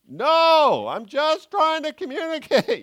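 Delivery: yelled reply. Retold or imitated. Spouses yelling across the house.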